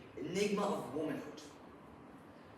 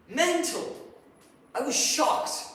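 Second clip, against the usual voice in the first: high, loud burst of disbelief; outraged; 10 semitones higher, 8 dB louder, slower than usual. Acted. Husband reacting to his wife's dice therapy, outraged. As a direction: blurt it out, appalled and loud.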